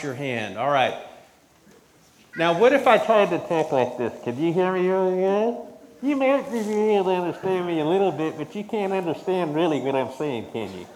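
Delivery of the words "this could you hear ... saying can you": muffled as if through a covered mouth; muffled speech. Shown as a demonstration. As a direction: talk with a hand over the mouth. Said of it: "it's all this kind of muffled".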